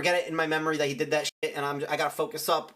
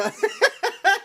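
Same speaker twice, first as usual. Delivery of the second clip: short, loud, high-pitched laugh; real high giggle; burst of laughter; 6 dB louder than usual. Real, not acted. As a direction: break up laughing mid-story. Laughs again mid-story.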